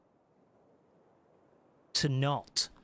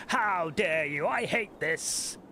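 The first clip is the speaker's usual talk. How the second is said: mock outrage; 4 semitones higher, much slower than usual.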